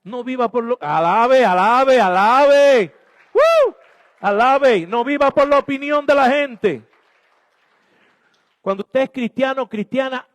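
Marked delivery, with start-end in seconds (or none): 0.8-2.9 loud, rising calls of alabe; shouted praise. Real, not acted. Preacher calling the congregation to praise, shouting. 3.3-3.8 sudden high, loud cheering whoop. Real, not acted. Preacher whooping at the congregation in praise, loud. 4.2-6.9 louder and higher, full-force preaching; preaching shout. Real, not acted. Preacher urging listeners not to live by opinion, shouting.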